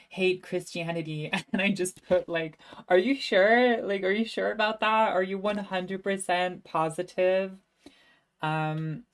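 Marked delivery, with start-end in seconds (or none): 2.9-7.5 sarcastic disbelief. Retold or imitated. Mocking her own margin note.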